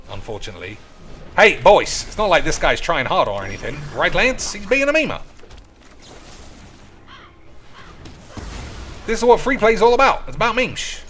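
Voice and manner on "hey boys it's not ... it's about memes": loud, higher, fast hype to viewers; excited hype. Real, not acted. Streamer hyping the boys about free play, loud fast hype. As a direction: pump up the crowd.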